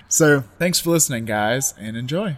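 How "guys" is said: ordinary talk, nothing marked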